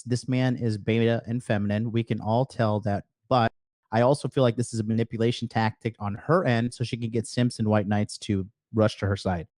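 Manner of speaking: ordinary talk, nothing marked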